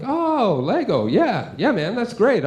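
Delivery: high, swooping mock delight; put-on enthusiasm. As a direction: humour a small child with overplayed excitement. Humouring a child's Lego invitation.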